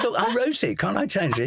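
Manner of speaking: high, laughing, exasperated plea; mock protest. Author pleading with producer to change his text, high laughing protest. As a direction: play up the outrage with a laugh in it.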